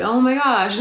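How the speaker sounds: exaggerated alarm, briefly acted; mock freak-out. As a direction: play a needy texter freaking out. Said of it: "they freak out and they're like".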